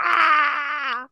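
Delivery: loud high yelp; excited cry. Real, not acted. Speaker reliving the moment, crying out loud. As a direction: let out a sharp excited cry.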